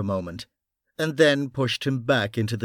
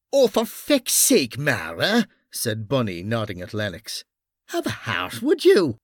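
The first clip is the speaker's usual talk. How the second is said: Irish detective voice. Acted. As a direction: scold him with mock outrage. Bunny indignant.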